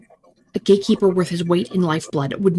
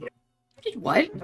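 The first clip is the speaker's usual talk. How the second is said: slow, high, breathless disbelief; shocked; 9 semitones higher, 5 dB quieter, much slower than usual. Acted. Shocked reply to husband killed.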